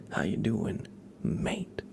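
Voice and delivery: "mate" set apart after a short pause and punched; greeting with the weight on a separate "mate"; stress on MATE. Shown as a demonstration. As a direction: hold back, then land on "mate". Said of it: "emphasizing a different word each time".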